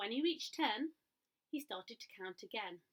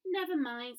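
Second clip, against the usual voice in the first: put-on consoling girl; 4 semitones higher, 8 dB louder than usual. A narrator's reading. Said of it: "said the little girl".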